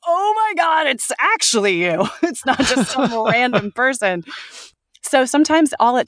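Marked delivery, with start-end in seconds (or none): 0.1-4.2 high, amazed outburst; astonished realization. Retold or imitated. Podcast hosts realizing it's her, high and amazed. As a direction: gush with wide-eyed surprise. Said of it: "They were like".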